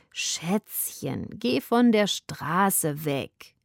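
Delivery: langsam gedehnt, lässige Katze; drawled. Acted. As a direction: zieh die Worte träge und langsam. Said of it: "sagte sie gedehnt". (langsam gedehnte Katzenstimme)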